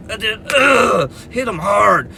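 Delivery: grunting shout of effort. Retold or imitated. Husband attacking the intruder in the dark, effort shout. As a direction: throw everything into the hit with a yell.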